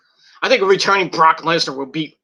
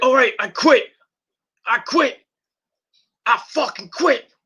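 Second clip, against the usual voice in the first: high, insistent acted surrender; mock desperate surrender; 8 semitones higher than usual. Wrestler giving up to save his brother, acted desperation. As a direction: imitate a beaten wrestler giving in, voice pitched up.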